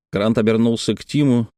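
ordinary delivery, nothing marked

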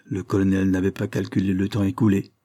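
usual speaking voice

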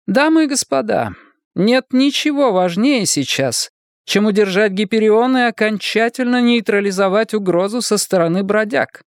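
high-pitched voice of a woman in command; decisive woman leader. Acted. Voices Gladstone addressing the meeting. (властная руководительница, высокий голос)

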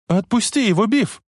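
high-pitched acted cry; girl's cry. A narrator's reading. Girl shouts from car at bully, high cry. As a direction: cry out high, without full volume. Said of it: "закричала".

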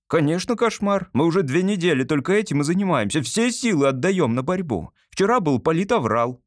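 put-on high, eager boy activist; zealous son. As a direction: report the struggle with fervent pride. Narrator voices the zealous son.

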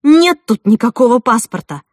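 loud, triumphant announcement. A narrator's reading. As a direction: declare it loudly as proof. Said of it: "Мой голос торжествующе дрожал".

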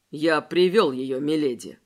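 low servile voice. Acted. Servant reports to his lady.

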